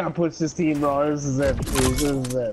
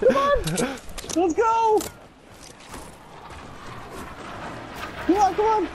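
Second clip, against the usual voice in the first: excited; 12 semitones higher than usual. Real, not acted. Frantic in the fight.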